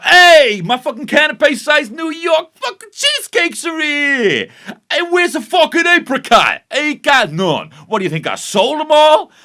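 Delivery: high, loud hammed-up yelling; mock outraged rant. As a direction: rant at the top of your voice, exaggerated. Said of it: "says Prue".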